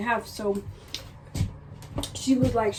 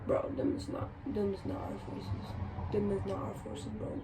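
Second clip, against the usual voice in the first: hushed retold aside; 11 dB quieter than usual. Quietly telling brother at counter.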